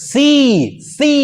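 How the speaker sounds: সি said alone and drawn out, twice; isolated, stretched সি to show the sound; consonant demonstration. Shown as a demonstration. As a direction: hold each সি long, with a pause between. Demonstrates consonant touching the teeth.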